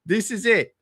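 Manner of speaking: high mocking voice, laughing through it; mock average dude. Mocking the guy who buys the scrub version, high and teasing. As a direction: sneer at the average dude's bike. Mocking the cheap bike buyer.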